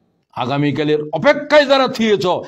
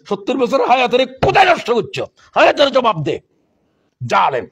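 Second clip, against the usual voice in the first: loud, raised judging voice; stern reproach; 6 semitones higher, 3 dB louder than usual. Acted. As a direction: thunder the charge at the sinner. Voicing judgment day questioning.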